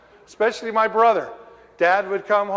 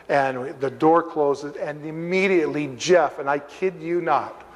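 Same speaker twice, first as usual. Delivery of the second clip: normal talking voice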